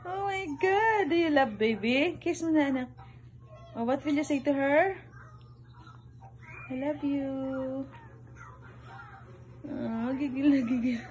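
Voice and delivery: put-on amused mother fussing over the kids; giggly high mommy voice. Mother laughing as toddler squeezes baby, high giggly coo. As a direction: laugh through it, pitched high and cooing.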